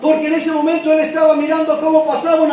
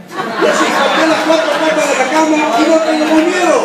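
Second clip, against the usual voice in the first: laughing; 2 semitones higher, 3 dB louder than usual. Real, not acted. Punchline of the joke.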